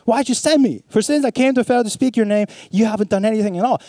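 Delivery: a touch of aggrieved victim, pitched high; quick, high, protesting plea; victim's complaint. Acted. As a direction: plead like a wronged victim, mildly hammed-up. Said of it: "That was a voice of a victim".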